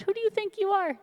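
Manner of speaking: high-pitched indignant challenge; retold scolding in a high voice; mock outrage.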